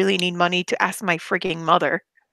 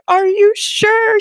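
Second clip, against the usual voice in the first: high, louder, slow panicked plea; panicked whine; 13 semitones higher, 7 dB louder, much slower than usual. Retold or imitated. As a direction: beg for reassurance, terrified. Scared self in retold call.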